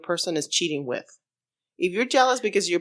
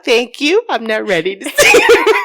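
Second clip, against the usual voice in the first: laughing mock reply; 6 semitones higher, 12 dB louder than usual.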